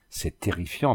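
mild frightened stammer; barely gets the words out, understated fear. Acted. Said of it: "balbutia-t-elle".

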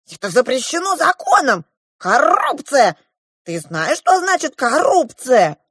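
high, loud dwarf outburst; indignant dwarf. A narrator's reading. The dwarf warns the girl of the law, indignant. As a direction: cry out high and scandalised.

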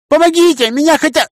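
very high loud scream for help. A narrator's reading. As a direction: scream it desperately. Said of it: "заорала".

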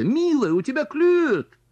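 high excited squeal; squealing aunt. Acted. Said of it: "взвизгнула тётя".

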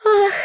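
high creature sigh; a weary exasperated ah; heavy sigh. A narrator's reading. Nora sighing over their fate, heavy sigh. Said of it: "тяжело вздохнула Нора".

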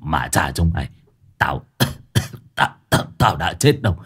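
low, halting sputter with a cough; groggy protest. A narrator's reading. Revived Lâm to his mourning friends, groggy stammering protest. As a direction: stammer it out low as you come round.

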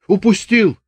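lightly voiced Chubaty, high exclamation; Chubaty's voice. A narrator's reading. Chubaty announcing to the sergeant, lightly voiced. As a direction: toss it off high.

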